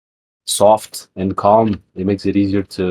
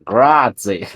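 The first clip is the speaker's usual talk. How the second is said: put-on Grazie in a thick English accent, flattened vowels; mock an Englishman saying Grazie. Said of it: "with the thickest English accent ever".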